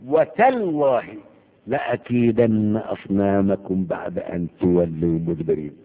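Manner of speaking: drawn-out, emphatic recited vow; solemn oath. Acted. Abraham vows to break their idols, in a slow solemn oath. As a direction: swear it slowly, stretching the words out. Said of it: "ويحلف على كده قال ايه".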